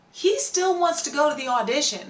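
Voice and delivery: high, loud exclamation; incredulous. Real, not acted. Telling viewers about Daniel after the fire, incredulous. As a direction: exclaim it in disbelief.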